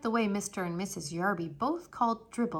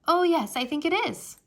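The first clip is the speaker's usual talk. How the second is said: bright agreement; 7 semitones higher, 6 dB louder than usual. Acted.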